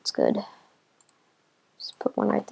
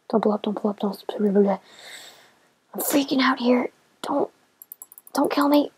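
anxious half-whispered begging; nervous pleading; 3 semitones higher, slower than usual. Real, not acted. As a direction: beg the creeper to spare you. Pleading with creeper nearby.

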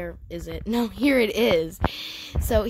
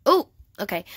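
usual speaking voice

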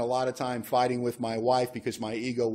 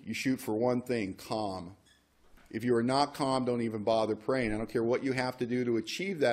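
ordinary talk

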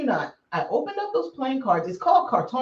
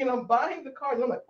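ordinary talk, nothing marked

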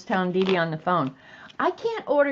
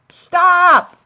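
much higher and louder, a sudden squeal of Stop!; mock exasperated shout; 10 semitones higher, 13 dB louder than usual. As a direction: cut him off with a shrill playful yell. Acted girlfriend's exasperated outburst.

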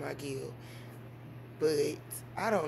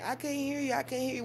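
mock complaint; 7 semitones higher than usual. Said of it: "I'm saying".

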